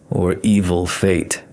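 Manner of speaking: plain reading voice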